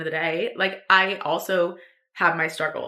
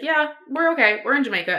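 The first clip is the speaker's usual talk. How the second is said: high, louder mock-casual reassurance; breezy brush-off; 7 semitones higher, 4 dB louder than usual. Answering worried friends simply, breezy brush-off. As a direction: imitate brushing off a friend's worry, pitched up. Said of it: "was giving really simple answers".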